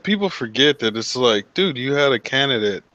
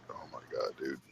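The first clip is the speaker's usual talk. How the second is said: stunned whisper; 10 semitones lower, 21 dB quieter, faster than usual. Retold or imitated. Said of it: "I'm like".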